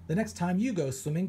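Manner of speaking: ordinary delivery, nothing marked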